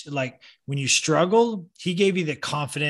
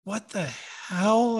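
high-pitched retold bewilderment; mock-baffled, pausing mid-phrase; 6 semitones higher, slower than usual. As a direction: replay being left dumbfounded, voice up. His baffled reaction to coach.

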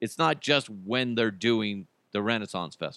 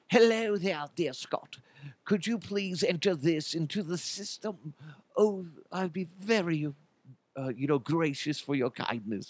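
high, lilting Renaissance-festival dialect; Renaissance fair dialect; 5 semitones higher, 5 dB quieter than usual. Retold or imitated. Mimicking a coworker asking to enter this, Renaissance fair dialect. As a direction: imitate her airy olde-worlde fair dialect. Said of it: "she would talk in that dialect".

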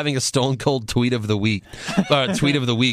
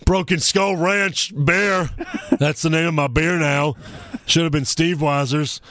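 Stone Cold impression; 5 semitones higher than usual. Reading Stone Cold's tweet in voice.

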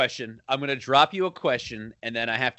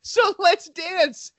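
high, slow mock Dangerfield taunt; slow and much higher, playful impression; hammed-up Dangerfield; 14 semitones higher, 4 dB louder, much slower than usual. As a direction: ham up the comic's gleeful retort. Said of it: "Dangerfield's like".

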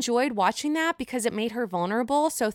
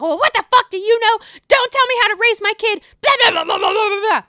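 much higher, louder, fast mock tirade; imitating a furious shout; 11 semitones higher, 7 dB louder, faster than usual. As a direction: shout her down like a furious mom. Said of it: "Heated, heated".

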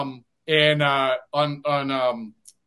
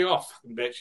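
muttered mock curse; 5 semitones lower, 8 dB quieter than usual. Imitating a player's curse.

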